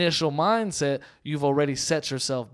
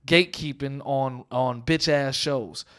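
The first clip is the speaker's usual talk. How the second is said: ordinary talk, nothing marked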